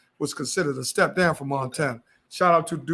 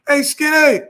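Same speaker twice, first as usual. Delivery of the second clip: loud call from the street, pitched up; 8 semitones higher, 10 dB louder, slower than usual. Retold or imitated. A man in the street hails him by nickname, loud call. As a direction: shout his nickname across the crowd.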